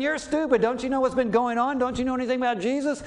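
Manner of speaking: high, loud, hurried insult; the name-calling Paul never did, shouted fast; angry retort. Retold or imitated. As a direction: lash out in scorn. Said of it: "No, he doesn't say that".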